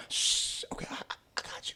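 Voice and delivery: hushed, quick whisper; acting out keeping a secret, whispered; conspiratorial whisper. Retelling promise to keep secret.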